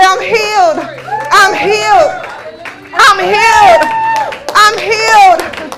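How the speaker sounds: loud, drawn-out cry of praise; retold joyful shouting; jubilant shout. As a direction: shout it out over and over, building. Retold woman praising, walking around.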